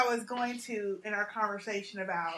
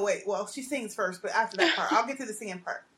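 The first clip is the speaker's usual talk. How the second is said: normal talking voice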